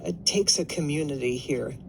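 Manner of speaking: normal talking voice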